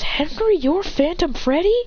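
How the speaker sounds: high-pitched disbelief, rising at the end; surprised question. Acted. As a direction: ask it amazed. Voiced character astonished at Henry.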